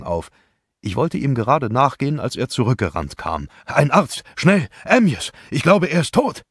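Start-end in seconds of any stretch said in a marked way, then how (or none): 3.6-6.4 high, loud alarmed cry for a doctor; frantic call for a doctor; panicked shout. Acted. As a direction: yell for help in panic. Friend runs back, finds body.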